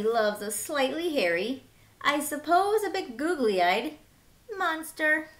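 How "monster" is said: usual speaking voice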